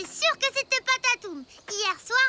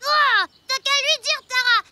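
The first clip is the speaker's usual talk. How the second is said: loud appeal; 7 dB louder than usual. Acted. Kid appeals loudly.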